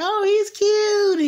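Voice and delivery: high, slow, hammed-up flirty purr. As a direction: play it up sweetly. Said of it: "he was like".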